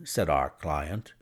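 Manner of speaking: ordinary delivery, nothing marked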